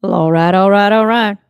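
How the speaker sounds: loud laid-back Texan catchphrase; Matthew McConaughey impression. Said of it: "do the best Matthew McConaughey".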